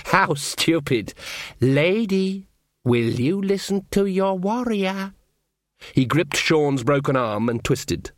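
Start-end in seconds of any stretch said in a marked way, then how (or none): 0.1-5.1 slow, sneering elf voice; mocking elf. Elf taunting Magrat in the dark, slow and sneering. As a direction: taunt her lazily, savouring it.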